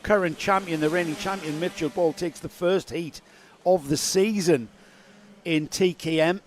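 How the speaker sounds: upbeat results rundown; sports commentary. Acted.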